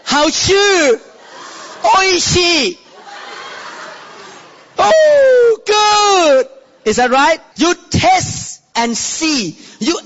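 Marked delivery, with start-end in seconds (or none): 0.0-2.8 delighted exclamation. Retold or imitated. Preacher imitating diners praising food. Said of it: "What you say in Mandarin". 4.8-6.5 very high, loud oh, good; ecstatic oh good. Pretend. Preacher acting out the first bite of good food. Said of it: "put food in your mouth and chew".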